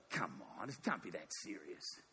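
soft, low brush-off; low dismissive protest. Acted. Lot waving off the angel's warning, quietly dismissive. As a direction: play it down under your voice.

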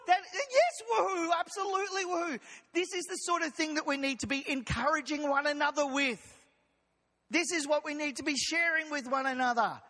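preacher cranking up the excitement; excited preaching. Shown as a demonstration. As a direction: voice jumps high, eager and bright. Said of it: "preach a bit more of an exciting message".

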